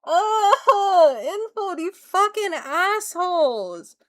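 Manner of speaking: high, drawn-out anguished complaint; exasperated wail. Real, not acted. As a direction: wail at the makers in frustration. Frustrated at game makers, wailing.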